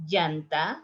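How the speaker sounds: Llanta said as "yanta"; y sound. Shown as a demonstration. Teacher demos tire with y. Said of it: "in the three different ways".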